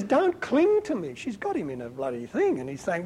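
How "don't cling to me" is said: high, drawn-out mock protest; play-acted plea to let go. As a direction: protest in a raised, slowed voice. Jesus acted, pushing off Mary's clinging.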